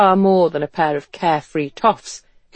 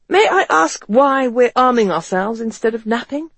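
high-pitched, puzzled gentleman; West, wry questioning; 7 semitones higher, 4 dB louder than usual. Acted. West asking Ethan about arming themselves, wry. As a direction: query it politely with pitch well up.

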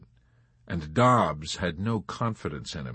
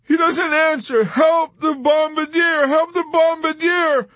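high, loud, panicked shouting; frantic cry; 8 dB louder than usual. A narrator's reading. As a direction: scream desperately for someone to help. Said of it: "Dobbs cried".

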